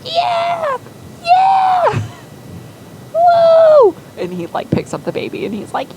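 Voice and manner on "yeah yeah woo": very high, loud falsetto cheering; ecstatic squeal. Acted. Zip cheering when offered the baby, ecstatic squeal. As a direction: squeal with joy like an overexcited kid.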